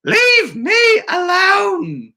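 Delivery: high, slow crying wail of the title; stretched-out whiny cry; mock wail. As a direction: wail it like a fed-up grandma. Title read dramatically, crying voice.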